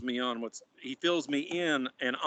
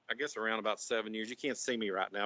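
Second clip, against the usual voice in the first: ordinary delivery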